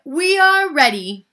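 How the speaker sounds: calling out. A narrator's reading. Maid tells the ladies she is ready, calling out. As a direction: announce it loudly across the yard. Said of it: "called Amelia Bedelia".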